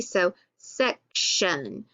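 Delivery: "section" slowly, short E, SEK-shun; teacher sounding out "section" slowly for the class. Shown as a demonstration. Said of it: "the E is short".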